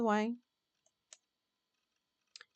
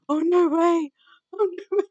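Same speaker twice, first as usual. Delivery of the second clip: much louder, higher squeal of delight; giddy delighted shout; excited; 7 semitones higher, 13 dB louder than usual. Real, not acted. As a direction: shriek it twice in disbelief. Reacting to Yoda-talking character.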